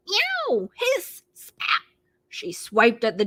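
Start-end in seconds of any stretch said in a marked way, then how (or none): 0.1-1.8 high mock meow and hiss; cat yowl. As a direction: warn the dogs off like a cat. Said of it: "two dogs bounded at her".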